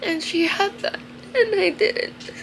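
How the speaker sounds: choked-up rise as the tears come; crying. Real, not acted. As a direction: push the words out while crying. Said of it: "Shit, I look so stupid".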